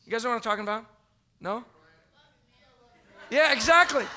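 usual speaking voice

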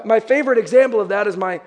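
ordinary talk

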